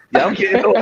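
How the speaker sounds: high laughing self-tease. Real, not acted. Host joking to mama about his age, laughing. Teasing about turning thirty-two.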